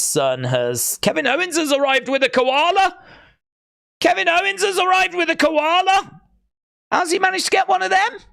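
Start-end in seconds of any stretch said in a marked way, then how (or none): 1.0-6.2 high, excited exclamation; giddy high-pitched announcement. Real, not acted. Excited announcement of koala arrival. 6.9-8.2 high, fast amazed question; squeaky delighted disbelief. Real, not acted. Amazed question about koala.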